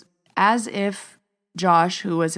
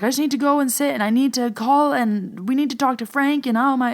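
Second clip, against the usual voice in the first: high, louder, rushed panic; freaking out; 8 semitones higher, 4 dB louder than usual. Retold or imitated. Telling Joe she must call Frank, freaking out. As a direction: spill it out in a flustered rush. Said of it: "I was kind of freaking out".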